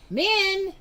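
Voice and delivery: high loud call; mom calling out. A narrator's reading. Mom calls daughter to supper, high loud call. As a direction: call her down from upstairs. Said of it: "yelled Mom".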